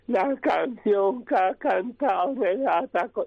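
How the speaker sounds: slow, high, put-on old aristocrat voice; slow mock-posh duchess impression; Duquesa de Alba. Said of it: "Y empieza la duquesa de Alba".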